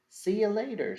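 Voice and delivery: a smug gator sending the frog off; storybook gator's gloating see-you-later. A narrator's reading. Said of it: "says the gator".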